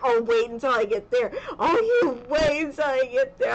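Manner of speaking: loud, mock threatening outburst; imitating angry husband. Husband threatening the hospital staff, a loud hammed-up angry threat. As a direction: act out his protective fury, hammed-up and loud. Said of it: "He gets so mad".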